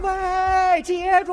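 loud high shout across the street; shouting after a runaway; calling out. Retold or imitated. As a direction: yell after him to catch it. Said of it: "这姑娘就喊上了".

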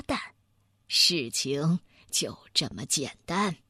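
low-pitched scholarly bear, measured explanation; deliberate bear detective. Acted. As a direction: lay out the solution unhurriedly.

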